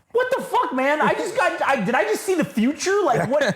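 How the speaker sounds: high, astonished rush of questions; stunned disbelief. Retold or imitated. After the ski run with Chloe, high astonished disbelief. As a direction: voice shoots up in amazement.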